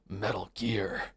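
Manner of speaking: low, quiet growled Snake voice; low raspy soldier growl; gruff Snake voice. Retold or imitated. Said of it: "Like, Snake goes".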